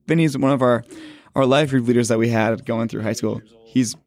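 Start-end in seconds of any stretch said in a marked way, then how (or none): none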